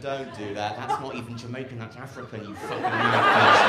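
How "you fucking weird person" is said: loud laughing insult; laughing shout. Real, not acted. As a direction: bellow it through laughter.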